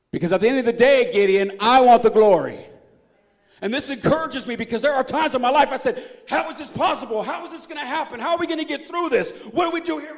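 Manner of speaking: loud, high, driving preacher's climax; full-force preaching. Acted. Pastor preaching to his congregation at full force. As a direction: preach it out to the back row.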